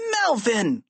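short, high, aghast exclamation; appalled kid's outburst; horrified cry. A narrator's reading. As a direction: cry the name out in horror, falling at the end. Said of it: "cried George, horrified".

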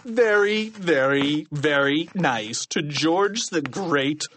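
slow character voice repeating lines; grudging punishment recital. A narrator's reading. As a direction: drone through the repeated very, drawn out. Krupp recites detention lines.

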